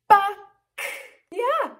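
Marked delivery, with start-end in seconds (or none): 0.1-0.4 short sharp hen's BUCK; one abrupt cluck; chicken cluck. Acted. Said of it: "A chicken says".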